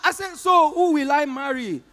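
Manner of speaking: normal talking voice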